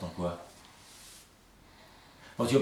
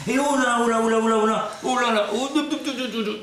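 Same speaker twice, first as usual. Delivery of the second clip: high loud panic; panicked; 11 semitones higher, 6 dB louder than usual. Acted. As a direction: cry out in alarm.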